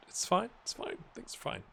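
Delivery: fading reassurance. Real, not acted.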